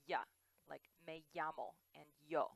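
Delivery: ya, llamo, yo with a firm Y; Spanish Y sound. Shown as a demonstration. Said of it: "they say it like".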